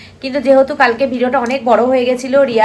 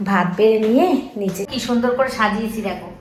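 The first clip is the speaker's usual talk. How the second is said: usual speaking voice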